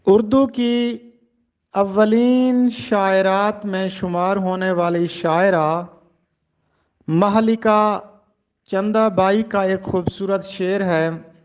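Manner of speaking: ordinary talk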